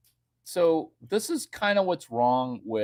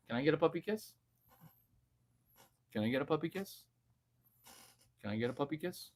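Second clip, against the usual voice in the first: fading softer coax to the dog; 10 dB quieter, much faster than usual. Real, not acted. Coaxing his dog for a puppy kiss, softer and softer. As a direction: ask the dog sweetly, quieter each time.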